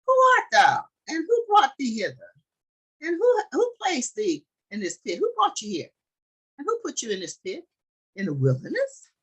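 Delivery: high-pitched calling questions. A narrator's reading. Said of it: "they called unto him and said".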